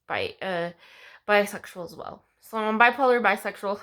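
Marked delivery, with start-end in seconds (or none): none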